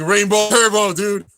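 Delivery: mock battle yell; retold yell. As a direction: yell the move name like the crowd. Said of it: "All yelling".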